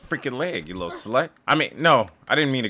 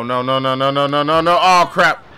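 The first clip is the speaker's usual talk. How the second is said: louder, rising panic; panicked no's; 2 semitones higher, 7 dB louder than usual. Real, not acted. Gamer panicking as police close in. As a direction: spiral into panic.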